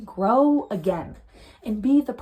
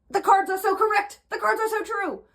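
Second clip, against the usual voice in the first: heated; 9 semitones higher than usual. Real, not acted. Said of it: "I'm getting so heated".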